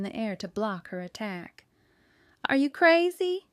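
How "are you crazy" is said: loud, higher yell on crazy. A narrator's reading. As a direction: cry out in shocked protest. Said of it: "she yelled".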